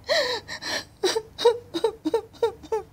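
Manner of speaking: long, high sobbing gasps; acted sobbing. A narrator's reading. Girl crying among the flowers, acted sobbing. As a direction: cry in repeated high catches. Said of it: "she cried and cried".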